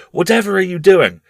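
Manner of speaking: crying out. Acted. Mother catching her son riding madly, alarmed cry. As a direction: demand it in shock. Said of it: "she cried".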